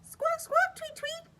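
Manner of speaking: high, squeaky bird chirps. Acted. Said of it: "said Bird".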